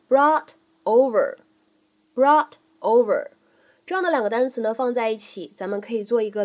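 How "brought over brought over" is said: brought over linked, slow. Shown as a demonstration. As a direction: say brought over slowly as one unit. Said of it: "连读".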